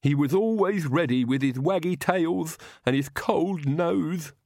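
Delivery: high, lisping tearful lament; sobbing. A narrator's reading. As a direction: sob it out with a thick lisp. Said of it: "Igor sobbed".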